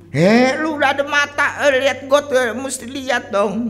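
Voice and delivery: mock scolding. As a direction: scold like a nagging bystander. Scolding someone who fell in the gutter. (omelan mengejek, suara jauh lebih tinggi dan keras)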